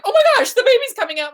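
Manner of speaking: high, loud and fast panicked gasp; panicked nurse. Retold or imitated. Nurse suddenly seeing the baby arrive, high, fast panic. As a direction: blurt it out like a startled nurse.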